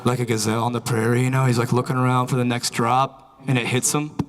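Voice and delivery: ordinary talk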